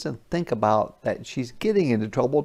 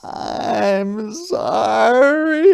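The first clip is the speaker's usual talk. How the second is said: high, slow wailing apology; crying sorry; 11 semitones higher, 6 dB louder, much slower than usual. A narrator's reading. Harriet crying to her mother, high loud wail. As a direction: wail it like a sobbing child. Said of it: "Harriet cried".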